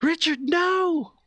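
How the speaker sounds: high, drawn-out desperate cry; slow wail to a companion, as a woman; anguished woman's voice. Acted. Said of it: "it's a woman's voice right now".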